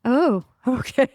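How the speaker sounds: retold okay with a laugh. Telling Liz she's fine with being sore, laughing. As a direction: laugh it off, a bit daunted. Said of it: "I said".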